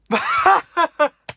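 high, loud burst of gleeful laughter; a sudden delighted squeal of a laugh; shrieking laugh. Real, not acted. Fan laughing at the rival's defeat, high shrieking laugh.

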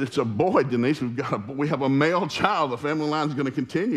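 high, loud and thrilled; excited new father. Retold or imitated. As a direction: announce the big news with proud excitement. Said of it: "So I got all excited".

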